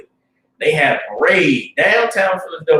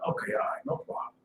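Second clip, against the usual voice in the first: breathy soft acceptance; hushed grateful yes; whispered reply; 15 dB quieter than usual. Retold or imitated. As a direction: whisper the thanks.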